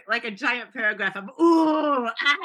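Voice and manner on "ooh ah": mock moaning. Written moaning lines for phone sex.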